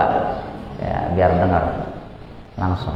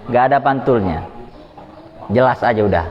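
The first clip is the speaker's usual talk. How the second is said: ordinary talk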